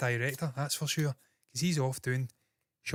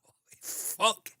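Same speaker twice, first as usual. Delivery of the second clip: slow, breathless whispered curse; shocked whisper; 8 semitones higher, much slower than usual. Real, not acted. Streamer seeing his red eyes, whispering in shock. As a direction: gasp it out in disbelief.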